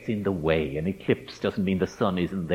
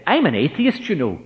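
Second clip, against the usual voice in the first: high, loud Northern Irish outburst; blurted Northern Irish accent; 7 semitones higher, 5 dB louder than usual. Retold or imitated. Student interrupting his teacher over a King Lear essay, blurting in Northern Irish accent. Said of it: "he blurted out in his north of Ireland accent".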